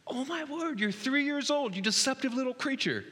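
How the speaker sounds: high, incredulous and half-laughing; amused disbelief. Real, not acted. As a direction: marvel at the little liar. Amused disbelief at toddler's lie.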